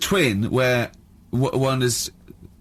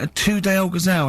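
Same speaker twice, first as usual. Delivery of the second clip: higher, prompting question; coaxing question; 7 semitones higher than usual. Retold or imitated. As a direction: nudge him back to the gazelle. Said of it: "And I went".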